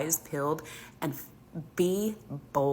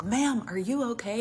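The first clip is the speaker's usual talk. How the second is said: concerned man; 8 semitones higher than usual. Retold or imitated. Concerned bystander.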